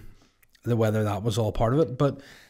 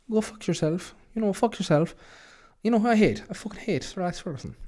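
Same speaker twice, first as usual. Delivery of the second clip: bad high Irish footballer voice, pitched way up; a clumsy, hammed-up Roy Keane; terrible Roy Keane impression; 9 semitones higher than usual. Host badly imitating Roy Keane ranting about Ferguson. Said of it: "That was a terrible impression".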